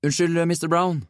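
high, meek mock apology; feigned innocence. A narrator's reading. Nick apologises to the inspector, playing innocent. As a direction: apologise all innocent, pitched well up. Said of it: "så veldig uskyldig ut".

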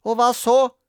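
put-on high, loud childish retort; defiant, bossy comeback; dismissive. A narrator's reading. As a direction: brush off a playmate's claim. Grete dismisses Robin.

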